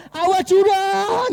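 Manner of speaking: high, loud, slow yell of alarm; shouted warning. Shown as a demonstration. Preacher demonstrating a loud yell before a cliff. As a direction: bellow it out to stop them. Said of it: "teriaknya harus kenceng".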